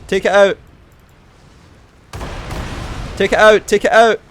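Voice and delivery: high, loud, urgent commands; urgent shouted orders. Real, not acted. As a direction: bark it at the squad. Urgent orders mid battle.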